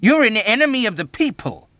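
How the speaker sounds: very high, loud accusation; outraged shout; denouncing. A narrator's reading. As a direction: cry it out as a denunciation. Major's tirade peaks.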